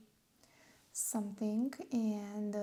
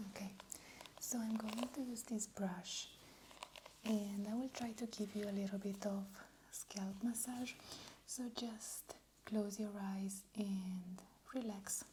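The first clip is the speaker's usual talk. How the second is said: whispered asmr role play; 3 semitones lower, 14 dB quieter than usual. Acted. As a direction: whisper soothingly as if massaging. Scalp massage in ASMR whisper.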